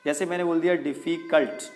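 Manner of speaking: normal talking voice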